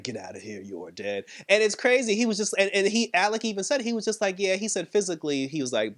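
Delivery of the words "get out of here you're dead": low, soft creature dismissal; Swamp Thing. Retold or imitated. Creature dismisses Alec's presence, low and soft. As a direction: send him off in a low, quiet voice.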